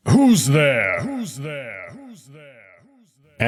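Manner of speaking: echoing shout into the void; snarling call, echo trailing off. A narrator's reading. Wolf shouting at the void, echo fading. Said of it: "Amicus shouts into the void, snarling".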